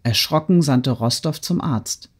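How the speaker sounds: usual speaking voice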